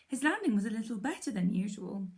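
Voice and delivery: usual speaking voice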